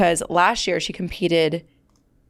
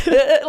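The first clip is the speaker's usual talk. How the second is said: shaky grunts; 6 semitones higher, 5 dB louder than usual. Retold or imitated. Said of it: "literally just shaking".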